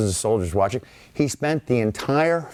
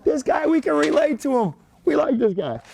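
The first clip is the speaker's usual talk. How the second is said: much higher, laughing-along gush; delighted soldiers. Retold or imitated. American soldiers cheering von Steuben, high delighted gush. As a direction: gush like soldiers who love their new drillmaster. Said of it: "the Americans are doubled over".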